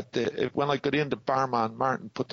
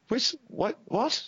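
high, incredulous "which, what, what?"; shocked disbelief; 11 semitones higher, slower than usual. Retold or imitated. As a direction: squeak out a baffled what. Retold shocked reaction.

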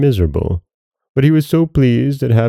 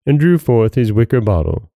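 usual speaking voice

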